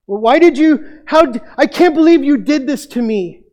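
high, loud, exasperated reproach; a frantic mother scolding her lost son; complaining mother. Retold or imitated. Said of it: "So you can hear that complaining".

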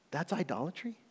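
hushed, gasping disbelief, rising into a question; gasp, then ask it very softly; mock shock. Preacher voicing a listener's reaction to Paul's word, mock shock.

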